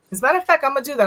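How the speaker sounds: normal talking voice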